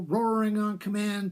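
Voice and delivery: plain reading voice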